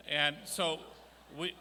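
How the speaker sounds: ordinary talk, nothing marked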